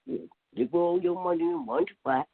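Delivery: ordinary delivery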